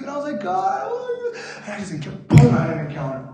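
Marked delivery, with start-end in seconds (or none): 0.4-1.4 high, laughing-crying cry; overwhelmed cry to God. Retold or imitated. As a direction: break into tears and laughter. Retold tearful cry to God.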